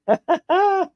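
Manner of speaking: short high giggle. Real, not acted. Giggling after teasing his friend for being lazy. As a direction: giggle at your own teasing.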